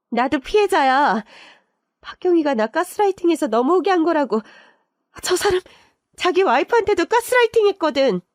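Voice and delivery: high-pitched, whining self-justification; desperate protest. Acted. Cornered lover claiming to be gaslit too, desperate protest.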